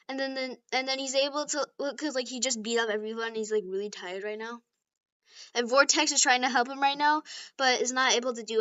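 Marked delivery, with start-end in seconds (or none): none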